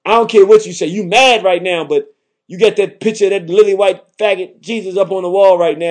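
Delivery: ordinary talk, nothing marked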